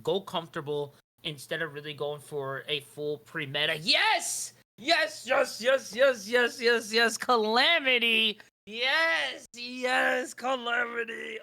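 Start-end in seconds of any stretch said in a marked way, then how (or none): 3.8-11.4 excited shouting. Real, not acted. Caster hyping a big play.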